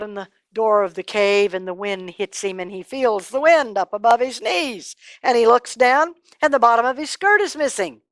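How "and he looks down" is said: ordinary delivery, nothing marked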